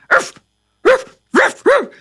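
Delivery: high, loud barked ruffs; dog barking. Acted. Narrating the dog chasing burglars, high loud barking. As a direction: bark it out like a dog. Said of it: "up and barking".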